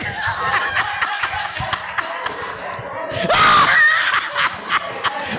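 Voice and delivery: high, breathless shrieking laugh that almost cries; overcome, squealing with joyous laughter; ecstatic laughing shriek. Real, not acted. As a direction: lose it to holy laughter. Preacher overcome with joy.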